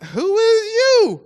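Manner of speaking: high, slow, stunned "who is you"; baffled who-is-you. Retold or imitated. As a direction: play it dumbfounded and drawn out. Confronted at his door.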